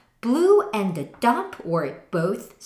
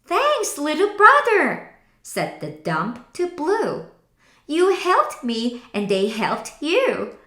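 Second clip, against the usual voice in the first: higher, warm and sincere; grateful thanks; 6 semitones higher, 3 dB louder than usual. Acted. The dump truck thanking Blue for helping. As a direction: thank him from the heart.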